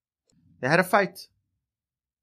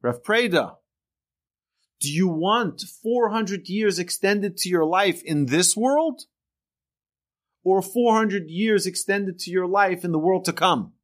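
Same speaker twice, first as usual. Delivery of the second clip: lightly voiced heavenly proclamation, pitched higher; 8 semitones higher, slower than usual. Heavenly voice offers the rabbi his reward, proclaiming. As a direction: raise the pitch and proclaim it from on high. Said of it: "A heavenly voice came out and said".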